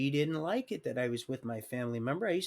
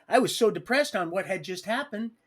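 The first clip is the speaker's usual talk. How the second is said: normal talking voice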